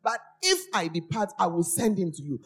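scripture proclamation. Acted. Preacher quoting Jesus promising the Comforter, emphatically proclaimed. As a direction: proclaim it phrase by phrase.

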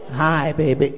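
low, affected lover's greeting; mock a man faking a smooth voice; low, put-on smooth talker; put-on sweet-talk. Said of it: "You don't talk like that".

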